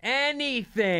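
high, stretched-out exasperation; exasperated parent. Retold or imitated. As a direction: throw it out high, at wit's end. Exasperated parent retold to kid.

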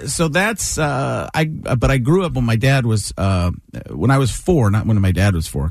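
normal talking voice

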